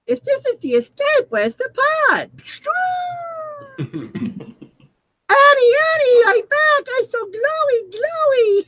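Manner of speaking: very high goofy alien voice; squeaky silly ghost; Jar Jar voice. Acted.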